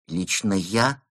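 hesitant question, rising at the end; timid query. A narrator's reading. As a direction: check meekly, as if hoping otherwise. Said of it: "робко уточнил он". (робкое уточнение)

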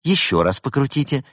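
high, eager helpdesk instruction; cheerful young technician. A narrator's reading. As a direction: instruct brightly like a young support worker. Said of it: "молодой и жизнерадостный".

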